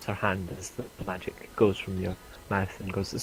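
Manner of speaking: ordinary talk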